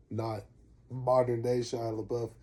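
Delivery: usual speaking voice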